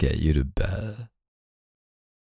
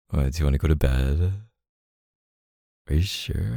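normal talking voice

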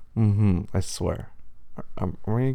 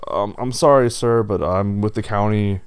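louder wolf voice, apologetic official; county official wolf; 6 dB louder than usual. Acted. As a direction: deliver the complaint loudly as a county official.

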